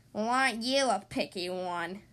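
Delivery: low, haughty creature jibe; sniffy disdain. A narrator's reading. Rejected girl monster scolds the picky child, sniffy. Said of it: "she sniffed".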